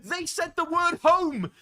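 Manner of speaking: high, loud, slow fan squeal over the word home; gushing like a fan who caught a hint; excited squeal. Retold or imitated. Fan shrieking at a quest line.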